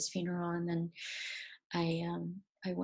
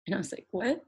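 real tears breaking through; choking up retelling the call; getting choked up; 3 semitones higher than usual. Real, not acted. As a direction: voice cracking, rising high. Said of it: "I'm getting, like, really".

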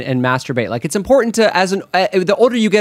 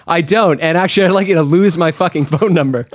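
normal talking voice

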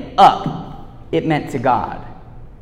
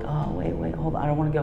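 put-on small, soft, scared child voice; a hint of a timid little daughter; scared little girl; 11 dB quieter, faster than usual.

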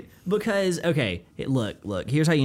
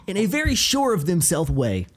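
higher, confident anchor voice; modern newscaster; 9 semitones higher than usual. Shown as a demonstration. Demonstrating the newscaster voice, a higher confident anchor delivery. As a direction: sound sure of yourself like a TV anchor. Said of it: "the modern newscaster voice".

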